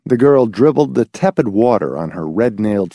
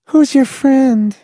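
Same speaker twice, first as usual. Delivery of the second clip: put-on high, slow demanding girl voice; sharp little blonde; 3 dB louder, slower than usual.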